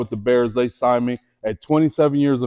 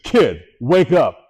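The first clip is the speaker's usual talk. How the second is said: slow, firm mock coach voice; a hint of a stern D-line coach waking him up; coach scolding; 2 semitones higher, 3 dB louder, slower than usual. D-line coach to nervous rookie, firm slow scold.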